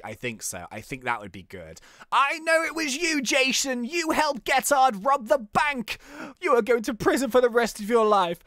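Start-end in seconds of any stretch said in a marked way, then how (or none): 2.1-8.4 high, hammed-up accusing detective; dramatic accusation. Detective confronting the bank robbery suspect, theatrical accusation. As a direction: declaim the charge, pitched up, drawing it out.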